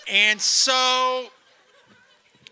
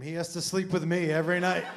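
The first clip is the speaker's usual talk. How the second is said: usual speaking voice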